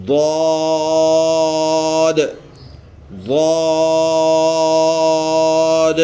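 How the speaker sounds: ضاد held long with tongue edge to the molars; drawn-out Zuad. Shown as a demonstration. Teacher demonstrates the letter for children: long heavy ضاد. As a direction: stretch ضاد one alif, heavy. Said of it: "اور لفظ ایسے ہی ادا ہوگا".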